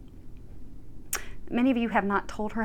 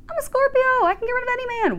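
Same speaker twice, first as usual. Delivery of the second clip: much higher and louder, a retold wail of despair; mock distressed high wail; 14 semitones higher, 4 dB louder, faster than usual. Reader retelling her upset prayer, in a high mock wail. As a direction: pitch it way up like a frustrated plea. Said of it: "I was so upset 'cause I'm like".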